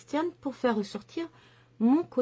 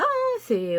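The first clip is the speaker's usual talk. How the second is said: ordinary delivery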